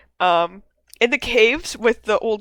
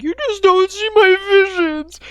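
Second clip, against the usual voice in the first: hammed-up high, loud outburst; Dutch yelling; 12 semitones higher, 6 dB louder than usual. Podcaster imitating Dutch yelling at Arthur, high mock yell. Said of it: "Dutch is yelling at Arthur".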